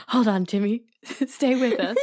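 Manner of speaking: mock plea through laughter; giggling fake urgency; laughing. Mock plea to dying child.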